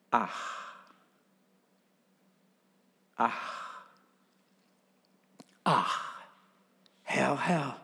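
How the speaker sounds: soft breathed-out sighs of reluctance; sighing. Retold or imitated. Jeremiah sighing to God against his call, soft sighs. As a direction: sigh each ach like a weary burden. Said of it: "Das Ach ist ein Seufzer".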